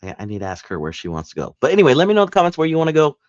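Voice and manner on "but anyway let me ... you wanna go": loud and very fast; rushed. Real, not acted. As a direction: blurt it out in a rush.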